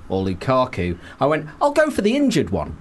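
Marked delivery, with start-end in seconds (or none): 1.6-2.8 high, laughing self-mockery; mock decision voice. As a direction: deliver it like a silly confident choice. Retelling own choice, mocking.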